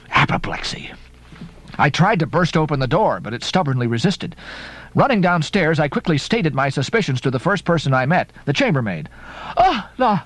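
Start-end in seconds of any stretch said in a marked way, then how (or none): 0.1-0.9 a breathless whisper of dread; whispered fear. Acted. Ishmael fearing the worst for Queequeg, whispered. Fearful muttered thought. 9.6-10.2 high, startled maid's exclamation; chambermaid cry. Acted. Chambermaid answering Ishmael, high startled cry. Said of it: "she cried".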